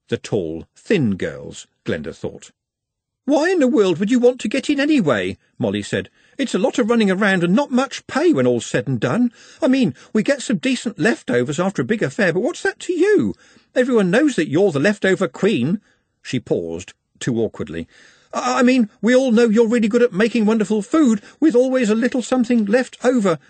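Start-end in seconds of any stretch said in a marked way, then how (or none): 3.2-5.4 high-pitched, incredulous maid; puzzled young maid. A narrator's reading. Maid questions Glenda about the banquet, puzzled. 6.4-15.8 high-pitched, chatty maid voice; chattering maid. A narrator's reading. Maid talks Glenda out of the banquet, chattering. 18.3-23.4 high, loud backpedalling; flustered gabble. A narrator's reading. Maid covers an awkward slip to Glenda, gabbling. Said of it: "she gabbled".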